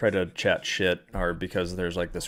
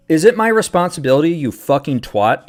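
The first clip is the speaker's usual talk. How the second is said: shouting, higher and louder, at a troll; angry outburst; 7 semitones higher, 9 dB louder than usual. Real, not acted. Streamer yelling at a rude chatter, real angry shout. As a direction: lose it at the chatter.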